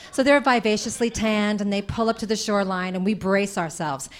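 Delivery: normal talking voice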